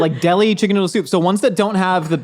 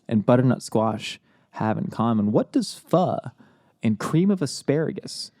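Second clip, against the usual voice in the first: NPR voice; 7 semitones lower, 5 dB quieter than usual. Acted. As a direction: muse like a radio essayist. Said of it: "I hate when you do this N P R voice".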